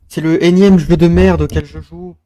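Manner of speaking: loud, high angry shout; raging gamer. Real, not acted. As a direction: bellow it in full fury. Rage continues, then repeated quietly. (joueur enragé qui crie)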